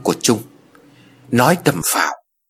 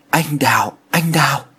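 voice gone astray; 4 semitones higher than usual. A narrator's reading. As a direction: call the name as if lost in fever. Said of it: "Giọng của Thiện lạc hẳn đi".

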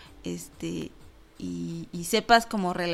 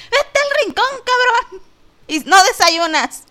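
much higher, louder hammed-up screaming teacher; furious teacher; 12 semitones higher, 10 dB louder, slower than usual. Pretend teacher screams at a pupil, over-the-top furious. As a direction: yell at the kid who got it wrong. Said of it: "sería algo así como".

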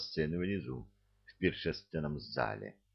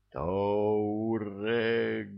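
drawn-out cry; 3 semitones higher, 3 dB louder than usual. A narrator's reading. Second cry to the heavens.

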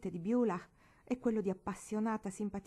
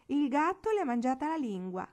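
high, loud jeering; mocking tease; 7 semitones higher, 7 dB louder than usual. A narrator's reading. Pete jeering at Marigold at the table, mocking. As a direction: poke fun at her silence. Relatives mocking shy girl.

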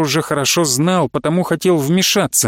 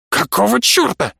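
higher, indignant exclamation; outraged; 4 semitones higher than usual. A narrator's reading. Professor finding his tent turned over, outraged. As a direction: burst out in affronted disbelief.

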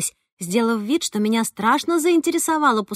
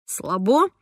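wry smirk. Acted. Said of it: "криво усмехнулся Ромка".

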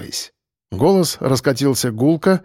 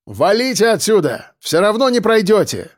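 high, projected dismissal; defiant shout; 7 semitones higher than usual. Acted. Defender telling attackers to leave, defiant shout. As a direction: send them packing.

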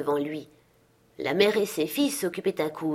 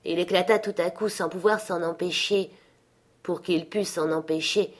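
usual speaking voice

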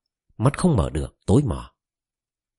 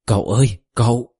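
shouting; 3 semitones higher, 3 dB louder than usual. A narrator's reading. Boy held by soldiers screams for his uncle, loud desperate shout. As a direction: yell for him while being held up by the neck. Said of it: "miệng gào to".

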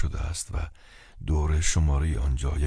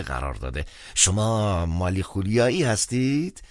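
high-pitched, eager bookish clergyman; chatty phone priest, lecturing; 8 semitones higher, 3 dB louder than usual. Acted. Priest recommends research to the caller, lecturing high-pitched. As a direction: lecture eagerly in a high voice, stretching the final question.

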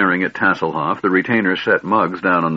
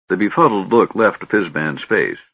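ordinary delivery, nothing marked

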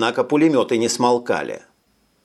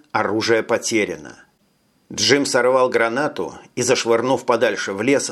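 ordinary delivery

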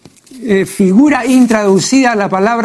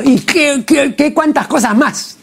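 much higher, piling up the list impatiently; exasperated exclamation; 7 semitones higher than usual. Shown as a demonstration. Critic acting out a weary speaker: exasperated exclamation. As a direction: sound fed up with listing more things. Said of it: "Como decir".

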